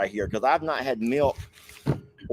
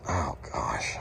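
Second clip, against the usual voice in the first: whispered; 10 semitones lower, 9 dB quieter than usual. Real, not acted. Whispered aside.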